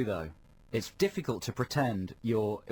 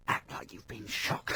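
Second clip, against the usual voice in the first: slow, whispered instruction into his ear; quiet aside; 5 dB quieter, slower than usual. Retold or imitated. Magician whispering to the contestant off microphone, slow and hushed. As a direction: whisper it so the audience can't hear, slowly. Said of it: "you just say to him quietly".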